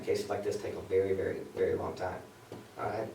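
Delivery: dry, fading voice; losing voice. Real, not acted. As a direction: voice giving out from dry air. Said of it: "I'm losing my voice".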